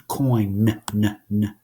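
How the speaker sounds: "coin" then a hummed "n" repeated; sounding out n. Shown as a demonstration. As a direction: hum the end sound "n" of coin.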